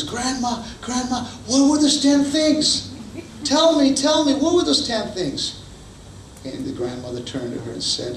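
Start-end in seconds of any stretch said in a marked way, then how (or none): 0.0-5.5 put-on much higher, pleading child voice; eager grandchild. Grandchild begging grandma to tell, high and eager. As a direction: beg like an eager kid.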